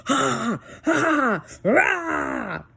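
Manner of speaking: loud, high mock rage yells; imitated frustrated roar. Mom imitating her son losing a game, raging yells. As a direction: imitate a gamer's exploding frustration.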